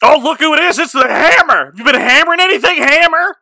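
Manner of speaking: high mocking drunk heckle; drunken mock taunt. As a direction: sneer at her like a drunk heckler.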